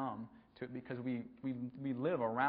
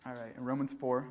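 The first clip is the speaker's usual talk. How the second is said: normal talking voice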